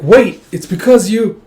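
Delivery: loud, high, drawn-out plea; urgent protest. Acted. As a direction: cry out slowly to stop someone. She cries out to stop him.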